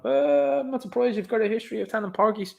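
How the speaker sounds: high-pitched put-on mocking voice; mock sceptic. Public answering media outlets, high mock-sceptical voice. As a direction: pitch it up high, sending up the reply.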